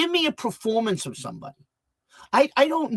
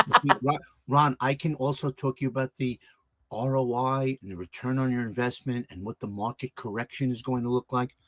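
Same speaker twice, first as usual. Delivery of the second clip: lower, softer, polished finance talk with no Brooklyn; clear businessman voice; 7 semitones lower, 4 dB quieter than usual. Shown as a demonstration. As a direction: speak low and clean like a banker. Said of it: "I can speak very clearly and not Brooklyn".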